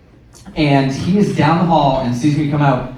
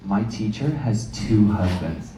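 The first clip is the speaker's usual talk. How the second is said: put-on low and soft kid voice; child's secret; 8 semitones lower, 8 dB quieter than usual. Child whispering a secret to the parent, low and soft. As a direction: confide it like a small child.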